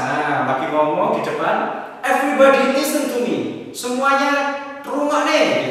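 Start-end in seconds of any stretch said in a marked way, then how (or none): 2.0-3.4 high, loud call for the class's attention; class captain calling out. Retold or imitated. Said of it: "Biasanya ketua kelas". 3.7-5.7 high, loud call for the class's attention; class captain calling out. Retold or imitated. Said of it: "kalau dalam bahasa Jawa".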